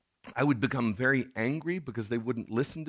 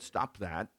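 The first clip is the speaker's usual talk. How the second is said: "Stop that" said low and soft, no force; a limp, unconvincing "Stop that"; a dad telling his kids off without meaning it; soft, half-hearted stop that; 4 semitones lower, 6 dB quieter than usual. Retold or imitated. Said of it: "in that tone, with that volume".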